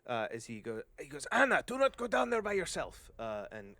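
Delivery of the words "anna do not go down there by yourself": high, loud warning shout; calling out. Acted. Dwarf calling to the woman heading down, urgent shout. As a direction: holler after her to stop. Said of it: "and calls out to the, uh, to the human woman".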